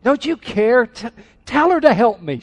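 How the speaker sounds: put-on high, loud childish protest; complaining little kid. Martha tattling on her sister, like a little kid. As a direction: mimic a squabbling child tattling on a sibling. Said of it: "Sounds like two little kids".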